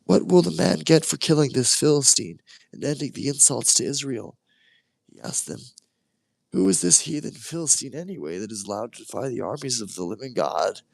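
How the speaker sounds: a lightly voiced high youthful David; a hint of a cocky young shepherd. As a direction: ask with bold indignation. David questions the soldiers.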